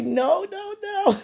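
high, drawn-out no-no-no; helpless pleading. Acted. Melting at child's pleading look.

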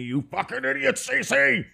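high, held-back mock yell. Streamer's own head scolding them for lateness, mock yelling. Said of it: "in my own head yelling at me".

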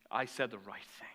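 low, hushed inner thought; soft, low mock satisfaction; self-satisfied. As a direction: voice a man quietly congratulating himself. Man's smug private thought.

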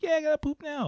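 put-on very high child's voice, urgent; young son. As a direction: imitate a small boy who can't wait. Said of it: "He's like".

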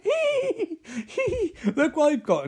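put-on high giggling little kid voice; gleeful kid. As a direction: giggle like a naughty kid with a prize. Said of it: "He's like".

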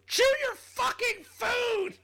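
high, slow, shrieking panicked yell; frantic shout at a choking man. Retold or imitated. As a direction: scream it at him in tearful panic. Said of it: "in pure tears and panic, she goes".

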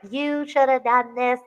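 high, drawn-out mock scolding; high-pitched slow imitation of a telling-off. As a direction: imitate someone telling a person off, slowly. Imitating Lala scolding with fingers.

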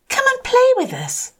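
very high, bright little fish voice. Acted. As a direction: call out like a tiny playful fish. Other fish calling Rainbow Fish.

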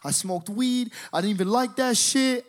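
high, pleading retold confession; tearful panicked confession. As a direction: blurt it out like an upset kid, mildly hammed. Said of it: "I start crying".

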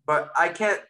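ordinary talk

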